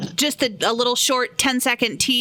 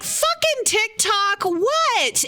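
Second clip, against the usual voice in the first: high-pitched, drawn-out indignation; mock outrage; 10 semitones higher, much slower than usual. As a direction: replay your disbelief, pitched way up and stretched. Retelling outraged reaction to violation notice.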